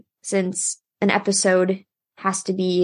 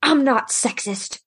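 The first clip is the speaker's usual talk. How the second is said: slow, higher-pitched mock protest; indignant retort; 5 semitones higher, slower than usual. Ed whips around to his brother, slow indignant retort. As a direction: draw out each word in acted indignation. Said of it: "Ed just whips around and says".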